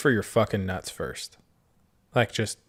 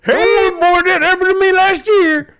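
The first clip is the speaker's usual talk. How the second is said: very high, loud hammed-up old miner; 11 dB louder than usual. Old miner greets the maimed victim, hammed-up squeal.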